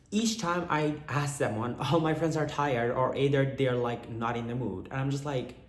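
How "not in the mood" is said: usual speaking voice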